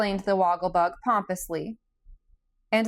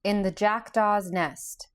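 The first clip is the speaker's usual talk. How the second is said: ordinary delivery, nothing marked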